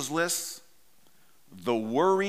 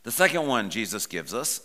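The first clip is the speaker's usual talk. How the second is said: normal talking voice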